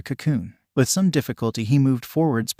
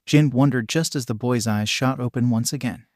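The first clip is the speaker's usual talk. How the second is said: usual speaking voice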